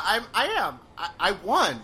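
ordinary delivery

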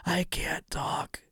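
voiceless, slow rasped whisper. Retold or imitated. Retelling the lost voice, a slow voiceless whisper. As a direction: whisper it like your voice is gone. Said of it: "I could not speak to save my life".

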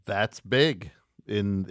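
ordinary delivery

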